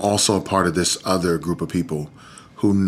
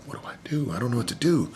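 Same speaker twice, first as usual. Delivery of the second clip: hushed panic; 4 semitones higher, 7 dB quieter, faster than usual. Retold or imitated. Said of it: "I'm looking at Danielle like".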